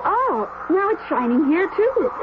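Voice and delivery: normal talking voice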